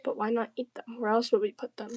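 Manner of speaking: usual speaking voice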